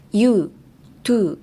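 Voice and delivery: "You, to" with straight, unrounded-out vowels; too straight. Shown as a demonstration. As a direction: say "You, to" as plain short oo, no glide. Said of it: "you guys say it too straight".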